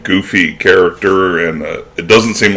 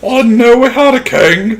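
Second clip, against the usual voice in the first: very high falsetto Python crone; comic high-pitched old lady; Monty Python old lady. Retold or imitated. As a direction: quote it in a squawking mock old-woman voice. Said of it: "turn this into nothing but quoting, like, Monty Python".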